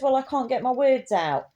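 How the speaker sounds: usual speaking voice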